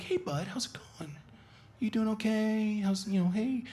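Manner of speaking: high, gentle coaxing; careful singsong so as not to scare him; quiet, inviting dog voice. Shown as a demonstration. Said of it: "mine is, like, really, really quiet and, like, inviting".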